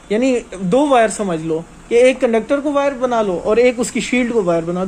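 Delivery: ordinary delivery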